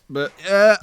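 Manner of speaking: ordinary delivery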